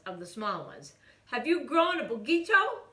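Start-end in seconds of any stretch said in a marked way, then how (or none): 1.2-2.9 high, loud little-dog voice; tiny chihuahua. A narrator's reading. Smallest chihuahua declaring to the kitten. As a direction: play the smallest of the pack.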